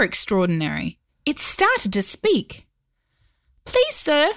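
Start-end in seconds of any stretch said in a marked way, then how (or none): 3.6-4.3 high, small creature voice; tiny gravelly voice. Acted. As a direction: squeak it like a tiny stone goblin. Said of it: "a tiny, gravelly voice call out".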